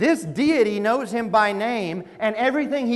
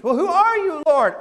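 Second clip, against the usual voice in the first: high, loud startled cry; acted alarm, pitched way up; mock frightened; 8 semitones higher, 6 dB louder than usual. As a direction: call out to the unknown voice, hammed-up. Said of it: "frightened by the whole thing".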